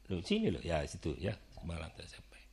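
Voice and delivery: low, soft, half-whispered instruction; hushed aside. Acted. Acting the teacher quietly calling the pupil over, hushed. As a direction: murmur it quietly as the teacher in the scene.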